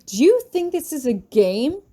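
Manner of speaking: angry shout. Acted. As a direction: shout it at the student. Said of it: "he shouts at me".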